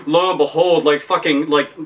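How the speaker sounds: normal talking voice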